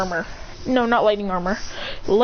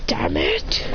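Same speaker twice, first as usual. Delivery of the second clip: low strained curse. Acted. Hero pinned by sword.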